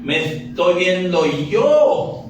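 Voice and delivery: normal talking voice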